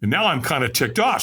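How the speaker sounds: normal talking voice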